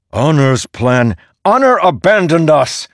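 loud, bitter outburst, leaping high; angry shout. A narrator's reading. Dalinar shouting at the storm god about Honor's abandonment. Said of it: "Dalinar shouted".

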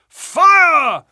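high, full-throated alarm cry of fire; group yell of fire. Acted. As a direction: yell it out to flush someone from hiding. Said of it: "we all yelled".

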